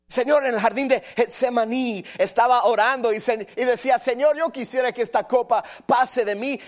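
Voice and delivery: high, loud pleading sermon voice; impassioned preaching. Acted. Preacher acting out Jesus's pleading prayer in the garden, high and loud. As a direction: plead it out at full preaching force.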